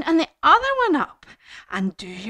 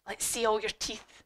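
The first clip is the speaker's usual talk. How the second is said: ordinary delivery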